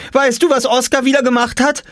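high eager tattle; tattling call. A narrator's reading. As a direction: tell on him to dad. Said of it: "rief Jule".

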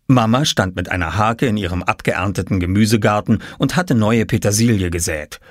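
ordinary delivery

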